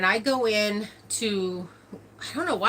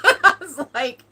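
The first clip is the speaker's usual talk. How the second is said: real laughter; 11 semitones higher, 8 dB louder than usual. Real, not acted. Laughing at the memory.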